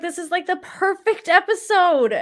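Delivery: high-pitched retold exasperation; exasperated. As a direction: insist on it in pained disbelief. Retold frustration over the episode.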